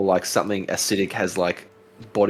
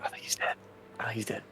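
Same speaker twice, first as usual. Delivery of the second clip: whispered; 13 dB quieter than usual. Real, not acted. Quiet verdict over body.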